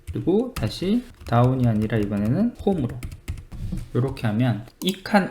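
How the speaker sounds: usual speaking voice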